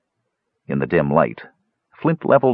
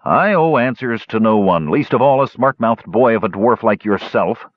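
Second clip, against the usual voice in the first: higher-pitched, tight-lipped rebuke; indignant dwarf uncle; 4 semitones higher, 3 dB louder than usual. Acted. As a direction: put the smart-mouthed boy in his place. Flint rebukes his nephew.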